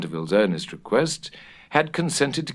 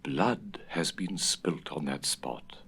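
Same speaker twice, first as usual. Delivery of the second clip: slow and much softer, ominous; low, slow voice; 3 semitones lower, 9 dB quieter, slower than usual. A narrator's reading. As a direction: let it sink in, softly and slowly. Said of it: "in a low voice".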